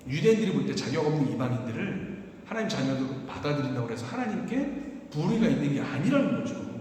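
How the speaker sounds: ordinary delivery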